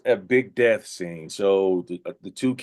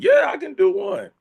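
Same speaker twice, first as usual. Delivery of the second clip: very high, eager boast; overconfident; 4 dB louder than usual. Retold or imitated. Actor asked to do an accent, overconfident. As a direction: pipe up high, all too sure.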